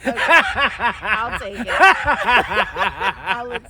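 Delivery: laughing through the words, slow and loud; words spilling out in laughter. Real, not acted. Laughing while speaking.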